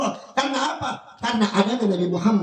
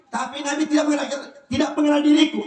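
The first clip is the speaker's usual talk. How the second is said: normal talking voice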